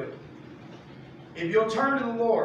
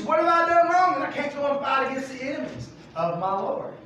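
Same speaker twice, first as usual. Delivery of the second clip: higher, louder, offended question; mock innocent protest; 8 semitones higher, 5 dB louder than usual. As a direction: ask as if deeply wronged. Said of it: "He says".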